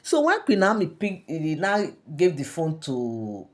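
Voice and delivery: ordinary talk, nothing marked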